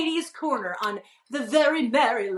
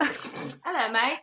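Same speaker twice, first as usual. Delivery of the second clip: put-on British greeting, softer; British accent; 4 dB quieter, much slower than usual. Shown as a demonstration. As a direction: say it like a Brit greeting a pal. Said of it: "British accent".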